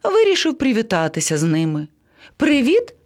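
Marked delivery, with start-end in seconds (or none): 2.4-2.9 high, rising greeting calls; calling out. A narrator's reading. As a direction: hail friends from afar, second call higher. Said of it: "гукнув до них".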